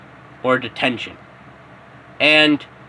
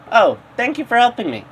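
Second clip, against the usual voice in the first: put-on lighter, higher, quick grateful girl voice; girly thank-you; 9 semitones higher, faster than usual. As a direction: chirp it out as a sweet schoolgirl. Uraraka voiced in story.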